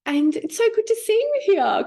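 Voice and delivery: put-on a fond old nan greeting her grandchild; nan's voice. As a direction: play the grandmother, overjoyed. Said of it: "And she goes".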